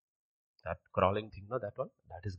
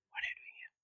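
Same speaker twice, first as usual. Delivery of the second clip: breathy, hushed whisper; 9 dB quieter, faster than usual. Acted. God asking Elijah in the cave, whispered. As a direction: whisper the question softly. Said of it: "he asks Elijah in a whisper".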